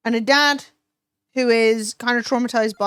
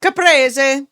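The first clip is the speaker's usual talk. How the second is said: eager food cry, louder; excited exclamation; 6 dB louder than usual. Real, not acted. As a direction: burst out with delight at the word. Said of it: "Sorry, I became hysterical".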